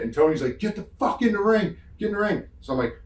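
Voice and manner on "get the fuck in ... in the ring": urgent order. Retold or imitated. Partner to stunned wrestler after the punch, urgent order. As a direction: order him back in, voice pitched way up.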